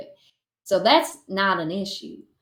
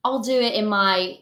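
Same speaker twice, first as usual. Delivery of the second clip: normal talking voice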